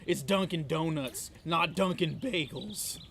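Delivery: Donuts and Bagels slow and punched; stress on Donuts and Bagels. Retold or imitated. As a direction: lean on Donuts, then on Bagels. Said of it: "As I said in the shop".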